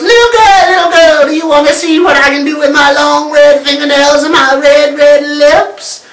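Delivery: high, loud sing-song witch. Acted. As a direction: lure her like a wicked witch. The witch at the door again.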